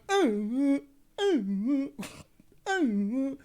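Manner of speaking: high, repeated half-dead moans; mock high moaning; imitated suffering groans. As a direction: groan like someone badly hurt, mockingly. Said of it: "he was suffering".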